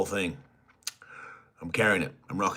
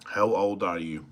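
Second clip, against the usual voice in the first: ordinary talk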